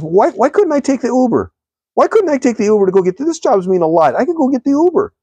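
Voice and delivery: the man whining at God about the Uber; whining complaint. Retold or imitated. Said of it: "He goes".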